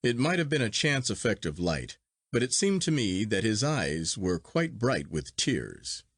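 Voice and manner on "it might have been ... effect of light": ordinary delivery, nothing marked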